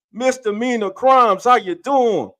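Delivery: high, loud welcome shout; hype greeting. Real, not acted. Host greets a viewer joining the show, hyped shout. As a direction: call the guest out like a hype host.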